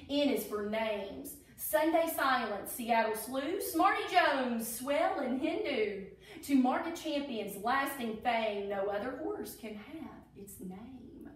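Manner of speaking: lilting rhyming storybook read; reading to children. Acted. Teacher reading a Derby alphabet book to children, storybook voice. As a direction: read each verse like a picture book for kids.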